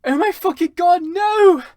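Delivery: loud, high agonised shriek; high anguished screaming. Acted. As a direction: shriek in pain. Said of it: "he started to scream".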